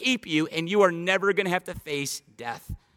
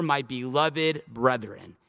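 slow, measured, reverent reading of the verse; drawn-out pulpit reading; solemn scripture reading; 5 semitones lower, slower than usual. Acted. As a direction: read each phrase slowly like a benediction.

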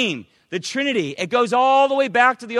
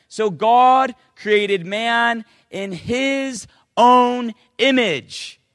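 loud, solemn reading of holy words; loud preacher reading scripture; 2 semitones higher, 6 dB louder, much slower than usual. Acted. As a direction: proclaim the verse to the congregation. Reading scripture aloud in sermon.

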